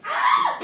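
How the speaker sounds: high loud yelp; sudden yelp. Real, not acted. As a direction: yelp when hit. Hit by dart.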